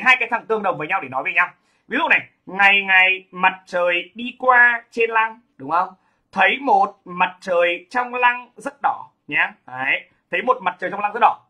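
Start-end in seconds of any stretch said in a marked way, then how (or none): 2.5-5.4 drawn-out, level-pitched line of poetry; slow verse recitation. Shown as a demonstration. Récite un vers en exemple. 6.3-9.1 very slow, drawn-out poetry line; slow verse recitation. Shown as a demonstration. Récite le vers, puis le redit.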